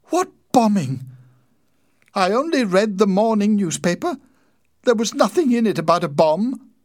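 very high, loud protest; horrified. A narrator's reading. Wife protests she knew nothing of bombing, horrified. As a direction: keep the alarm high and loud throughout. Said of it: "Winnie cried, horrified".

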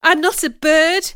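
high, loud objection; indignant outburst; protesting. A narrator's reading. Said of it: "I protested".

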